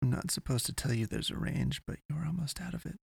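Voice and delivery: soft, high, rushed secret; hushed confession. A narrator's reading. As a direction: let it slip under your breath. She confides a forbidden secret.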